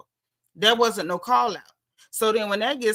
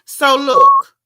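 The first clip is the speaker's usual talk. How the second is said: much louder and higher, grabbing the room; loud call for attention; 6 semitones higher, 13 dB louder than usual. Real, not acted. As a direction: shout to get everyone listening. Calls the chat to attention.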